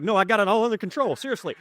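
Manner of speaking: hammed-up calm over nerves; mock bravado. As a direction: brush it off like nothing's wrong. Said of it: "I'm like".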